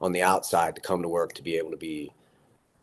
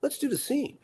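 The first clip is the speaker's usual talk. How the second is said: high, easygoing who cares about that; breezy, pitched up, waving it away; Redford brushing it off. Retold or imitated. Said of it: "Robert Redford just goes".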